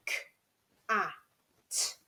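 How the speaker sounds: k, a, t, each sound alone like a robot; robot voice spelling out the sounds. Shown as a demonstration. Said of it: "pretend to be robots".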